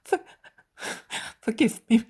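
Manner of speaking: broken sobbing apology. Acted. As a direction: beg forgiveness through sobs.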